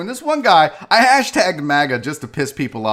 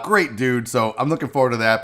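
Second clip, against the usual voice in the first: ordinary delivery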